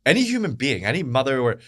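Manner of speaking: high-pitched, incredulous outrage; real outrage. Real, not acted. Commentator asking why anybody defends stoning, outraged. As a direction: demand an answer, voice pitched right up.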